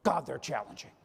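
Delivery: a hint of a cranky older manager; mock complainer. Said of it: "I hear people say all the time".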